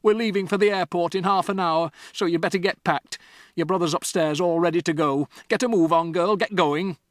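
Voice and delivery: loud, impatient dad giving orders; bossy father. Acted. Mister Wormwood ordering Matilda to pack, loud and impatient. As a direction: hurry her along. Father hurrying his daughter.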